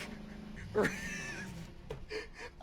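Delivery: breathless squeaky laugh, almost crying; silent wheezing laugh. Real, not acted. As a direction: laugh until no sound comes out. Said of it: "Sorry, I'm still laughing".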